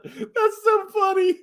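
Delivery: high, slow laugh-squeal; real giggling, drawn out. Real, not acted. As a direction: say it through laughter. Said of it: "thinks I'm faking this".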